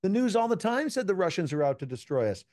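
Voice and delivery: high, baffled protest; retelling his own baffled reply; incredulous. Retold or imitated. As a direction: ask it in disbelief. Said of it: "I'm like".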